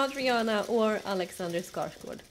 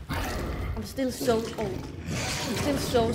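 scared; much slower than usual. Real, not acted. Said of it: "I'm still super scared".

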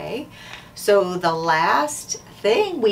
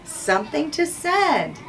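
higher, slow stress on each word; slow emphasis; 7 semitones higher than usual. Real, not acted. Teacher underlining the answer for the class with slow emphasis. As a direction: drive the answer home. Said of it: "teacher repeats the key phrase".

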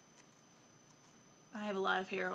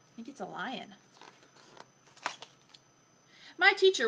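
put-on lively, expressive story reading for a young child; picture book reading; 2 semitones lower, 4 dB louder than usual. A narrator's reading. Adult reading Lion Lessons to a child, animated storytelling. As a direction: read it aloud with playful emphasis.

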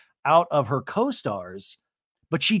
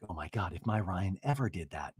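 hushed, low mock disbelief; retold shock; 8 semitones lower, 9 dB quieter than usual. Host voicing Jen's reaction to Katie's story, hushed shock. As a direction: imitate shock under the breath, soft and low. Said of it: "Jen seems very shocked as well".

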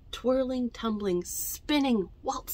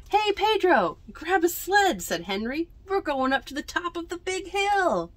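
put-on excited higher kid voice; eager call; 9 semitones higher than usual. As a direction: call a friend to the hill. Henry calling to Pedro.

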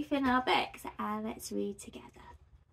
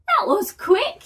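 high, lively chuckled remark; raised, playful storybook voice; chuckling delivery; 11 semitones higher, 8 dB louder than usual. A narrator's reading. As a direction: pipe it up brightly with amusement. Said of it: "he chuckled".

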